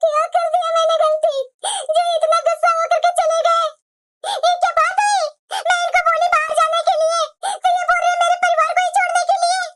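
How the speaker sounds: high-pitched tearful wail; crying. Acted. Abandoned wife lamenting her husband's demand, crying. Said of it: "अरे बेटा, मत रो बेटा".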